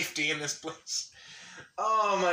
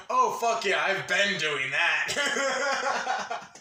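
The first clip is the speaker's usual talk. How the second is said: louder, higher eager reply breaking into laughter; gleeful retelling; 4 semitones higher, 5 dB louder, much slower than usual. Retold or imitated. Retelling their reply to Pegged, gleeful. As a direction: crow it proudly, then laugh.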